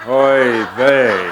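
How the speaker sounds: loud weary groan of a sigh; a mock fed-up Jesus, loud and laughing; exasperated oy vey. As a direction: roll your eyes through it. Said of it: "I see him going".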